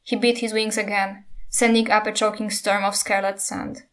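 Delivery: plain reading voice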